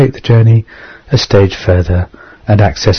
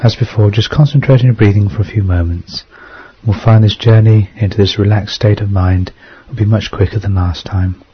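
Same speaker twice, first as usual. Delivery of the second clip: guided meditation. Acted.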